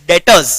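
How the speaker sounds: "debtors" said as "det-uhz", no B; careful model of "debtors" without any B sound; silent B. Shown as a demonstration. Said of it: "लेकिन उसको तुमको pronounce नहीं करना है".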